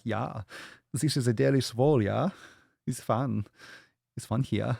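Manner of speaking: put-on light German accent, bouncy and playful; mock a German techno DJ with a light German accent.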